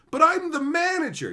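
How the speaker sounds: high, loud whiny mock of the manager. Mocking the manager's protest, high whiny voice. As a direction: squeak it like an indignant boss. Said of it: "they don't know what to do".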